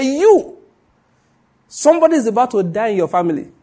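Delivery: loud, high prophetic pronouncement; booming prophet declaring a death; prophet's proclamation. Retold or imitated. Prophet pointing, announcing death.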